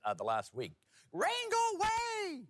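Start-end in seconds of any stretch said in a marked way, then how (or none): 1.1-2.4 high, slow shrieking plea; weird old lady scream. Retold or imitated. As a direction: wail it in a cranky old voice. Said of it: "that's what I was screaming".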